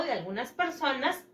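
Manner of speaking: ordinary talk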